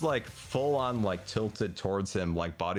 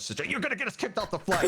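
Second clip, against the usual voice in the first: high, rushed plea; retold urgent warning, pitched up; urgent wifely scolding; 10 semitones higher, faster than usual. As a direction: imitate a wife frantically reining in her husband. Acted wife restraining her husband.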